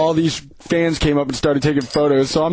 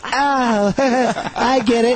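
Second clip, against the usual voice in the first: high, very slow dawning realisation; imitating her voice; 8 semitones higher, much slower than usual. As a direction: slowly get the joke, dimly. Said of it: "he's imitating her voice perfectly".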